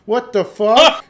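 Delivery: slow, high, loud disbelief; stunned outburst. Real, not acted. Player reacting to ghost reveal, stunned loud swear. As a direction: drag it out, truly floored. Player shocked by game twist.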